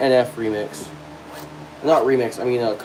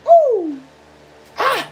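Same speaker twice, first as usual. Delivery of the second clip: very high sung ad-libs; falsetto ooh and ah; 3 dB louder than usual. Acted. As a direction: let out high falsetto exclamations.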